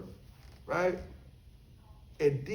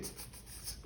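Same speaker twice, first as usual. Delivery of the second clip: soft unvoiced tss tss to lure a cat; cat-calling hiss; 19 dB quieter than usual. Man luring his cat, soft hissing tss. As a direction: hiss softly to draw the cat out. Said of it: "You know, I said".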